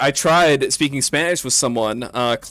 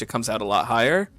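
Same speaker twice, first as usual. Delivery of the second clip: ordinary talk, nothing marked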